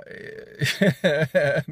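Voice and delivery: a bright burst of laughter at her own story; real laugh. Real, not acted. Laughs while summing up.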